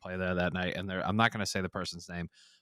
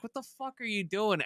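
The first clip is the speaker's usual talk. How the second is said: retold outburst; 12 semitones higher than usual. Being slapped around.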